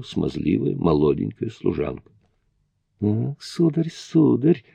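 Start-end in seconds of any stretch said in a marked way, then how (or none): 3.0-4.6 высоко, с укоризной и подъёмом; мягкий упрёк служанки; reproachful. A narrator's reading. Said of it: "укоризненно покачивая головой". (укоризненно)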